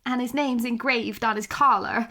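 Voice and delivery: ordinary talk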